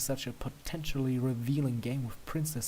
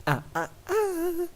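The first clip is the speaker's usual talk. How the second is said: much higher, slow teasing tut; playful halt; slower than usual. A narrator's reading. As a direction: scold playfully, up high. Luna halting you playfully.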